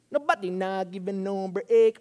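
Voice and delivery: badly faked Jamaican accent on a song lyric; fake Jamaican accent. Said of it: "Jamaican accent".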